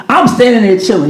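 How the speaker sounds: usual speaking voice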